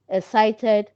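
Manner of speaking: ordinary talk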